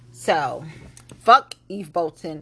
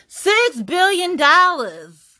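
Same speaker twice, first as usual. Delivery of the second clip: slow, higher and louder, each word hit; emphatic; 8 semitones higher, 6 dB louder, much slower than usual. Real, not acted. Host hammering the six billion in tax breaks. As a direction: drag out "Six billion dollars" with weight on every word.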